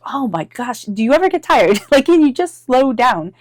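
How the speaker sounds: half-laughing, hammed-up exasperation; mock exasperation. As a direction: act fed up with someone's endless energy, laugh breaking in. Worn-out reply to eager friend.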